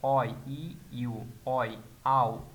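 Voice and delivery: slow, drawn-out German vowel sounds; teacher modelling German vowels; German diphthong demo. Shown as a demonstration. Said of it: "আও উচ্চারণ হবে".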